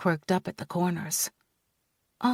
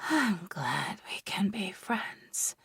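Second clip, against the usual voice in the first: slow, drowsy whispered slur; sleepy slur; 6 dB quieter, slower than usual. Acted. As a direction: drift off while saying it. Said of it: "Sleep slurred my words".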